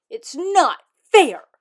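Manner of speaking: slow, very loud outburst; furious yell. Acted. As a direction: scream it in a tantrum.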